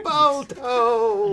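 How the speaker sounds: high, loud laughing cheer; excited cheer. Real, not acted. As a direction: shout the name with a laugh. Cheering a dog movie.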